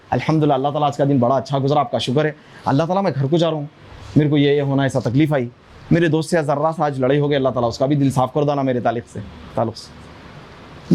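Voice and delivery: low-pitched, intimate chat with God; informal personal prayer. Shown as a demonstration. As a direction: model a child's simple end-of-day prayer, low and conversational. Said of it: "अल्लाह से उर्दू में बात करो". (नीची आवाज़ में अल्लाह से अपनेपन भरी बातचीत)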